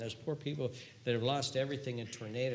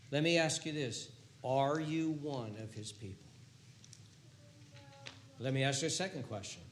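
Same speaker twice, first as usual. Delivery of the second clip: ordinary talk, nothing marked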